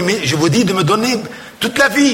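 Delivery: a mock plaintive protest, voice rising; acted tearful protest. Penitent protests the lighter penance to confessor, acted tearful plea. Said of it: "plus l'autre pleurait".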